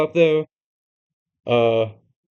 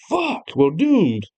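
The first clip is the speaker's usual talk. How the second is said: despairing groan; 4 semitones higher, much slower than usual. Retold or imitated. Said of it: "And they're like".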